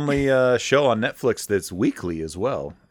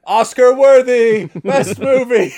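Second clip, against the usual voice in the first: high, loud overexcited praise; gushing rave; 13 semitones higher, 8 dB louder, slower than usual. Retold or imitated. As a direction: shout it like a thrilled fan. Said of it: "Lee's like".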